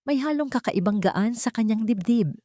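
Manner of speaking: ordinary delivery, nothing marked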